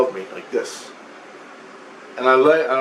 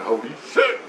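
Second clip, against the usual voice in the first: stunned laugh; 3 semitones lower than usual. Retold or imitated. Shock at the giant's exit.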